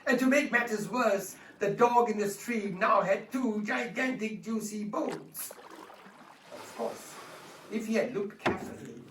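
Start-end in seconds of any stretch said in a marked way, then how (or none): none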